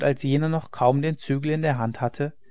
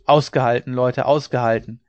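loud rallying call; restrained narrated shout; shouted encouragement; 6 dB louder than usual. A narrator's reading. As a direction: call down to urge the workers on. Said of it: "schrie er zu ihnen hinab".

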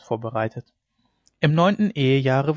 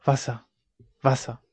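faint feverish whimper for water; understated sick woman's whimper. A narrator's reading. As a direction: plead weakly, a mild whimper. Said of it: "wimmerte die Kranke".

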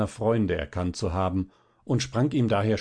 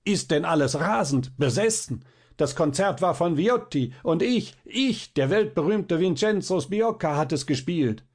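high-pitched wounded vanity, not truly shouted; outraged cry; 6 semitones higher than usual. Retold or imitated. Violinist protests to the raving audience, outraged. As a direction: protest with mounting indignation, peaking on ich. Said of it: "Noch ärger als zuvor schreie ich".

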